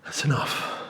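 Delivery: drained whispered afterthought; whispered echo. Retold or imitated. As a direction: breathe it out, spent and hollow. Echoing his own plea.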